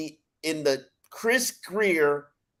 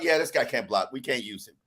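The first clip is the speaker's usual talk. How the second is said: resigned coaches; 6 semitones lower, 5 dB quieter than usual. Retold or imitated. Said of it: "figured out".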